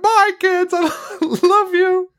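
very high, sweet put-on voice, slowing on "love you"; taxi driver goodbye. Taxi driver waving the family off, squeaky sappy voice. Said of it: "being like".

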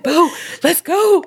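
high, drawn-out laughing command; laughing urgency. Retold or imitated. Telling Bo to leave the door, laughing urgently. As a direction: call the dog away while cracking up. Retells hurrying dog away, laughing.

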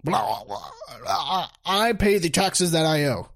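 sputtering imitation of a flustered politician; mocking, tongue-tied bluster; mock flabbergasted. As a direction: stammer nonsense, then blurt the line. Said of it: "He's totally flabbergasted".

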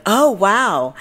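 imitation of a wowed stranger; mock impressed. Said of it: "she's like".

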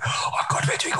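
whispered mock panic; panicked whisper. Sitting with the band, not knowing where to go, whispered panic.

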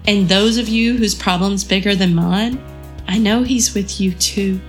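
ordinary talk

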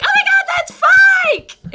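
very high, loud screaming fan; excited fan shout. Retold or imitated. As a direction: shriek at spotting the star. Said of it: "they're like".

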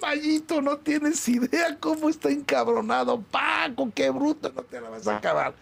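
high, drawn-out mock dismay; alarmed warning. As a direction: imitate someone wailing a warning in a high voice. Acts out a dismayed warning about Paco. (advertencia alarmada, imitada con voz aguda)

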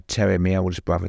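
ordinary delivery

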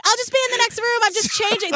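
high-pitched mock casual call; imitated decoy excuse. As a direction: pretend to call out lightly from the next room. Imitating decoy making excuses to leave.

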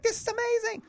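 high-pitched mock amazement; excited exclamation. Comic on seeing a buddy's ad all the time, high excited exclamation.

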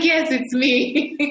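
slow, prim phone-answering voice; mock-formal reply, drawn out and polished; very proper. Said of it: "I answer very proper".

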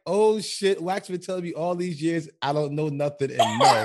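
imitating a friend caught out; mock panicked Nala. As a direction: ham up her shocked face. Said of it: "the Nala face was like".

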